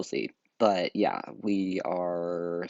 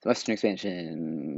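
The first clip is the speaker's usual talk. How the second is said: ordinary talk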